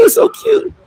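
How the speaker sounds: very high mock girly giggle and coo. Mock reaction to a text.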